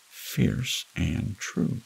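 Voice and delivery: ordinary delivery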